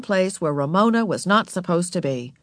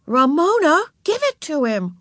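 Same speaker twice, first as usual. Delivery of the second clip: put-on higher, firm older-sister command; ordering; 8 semitones higher than usual. A narrator's reading. Beezus orders Ramona to return the lollipop, firmly. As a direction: make the little sister obey. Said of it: "ordered Beezus".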